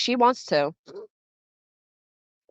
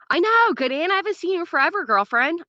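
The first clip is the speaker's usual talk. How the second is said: excited greeting; 9 semitones higher than usual. Real, not acted. Greeting a long-missed chatter.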